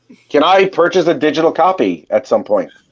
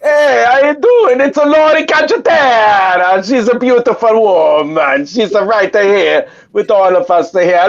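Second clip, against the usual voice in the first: higher, hammed-up Italian accent; 5 semitones higher than usual. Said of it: "pronounce Laurie's name in your Italian accent".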